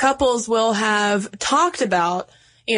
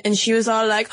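ordinary delivery

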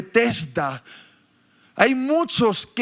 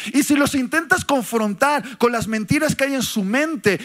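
ordinary delivery

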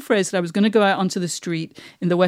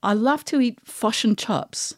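slow New Zealand accent demo; 4 dB quieter, slower than usual. Shown as a demonstration. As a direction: say it slowly with New Zealand vowels. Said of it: "New Zealanders say this".

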